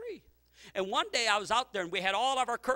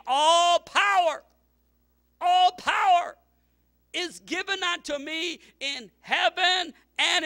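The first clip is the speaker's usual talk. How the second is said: booming, slow, high proclamation; full-force preacher's roar; preaching shout; 10 semitones higher, 10 dB louder, slower than usual. Acted. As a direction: thunder out the words of Jesus. Preacher voicing Jesus' words, shouting.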